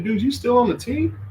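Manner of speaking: high-pitched incredulous jab; mocking disbelief. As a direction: ask it way up high, as if it's ridiculous. Mocks a benched player's talk.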